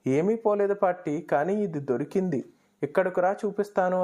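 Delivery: plain reading voice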